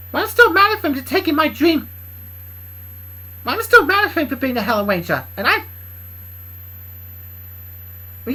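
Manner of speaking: high, loud sulky character voice; resentful Bob. Acted. Bob's resentful reply in character.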